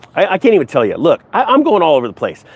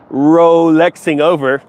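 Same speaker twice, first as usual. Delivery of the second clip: slowed pun, leaning on Rolexing; pun punchline; slower than usual. Shown as a demonstration. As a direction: drag out Rolexing to land the pun. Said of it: "See, see what I did there?".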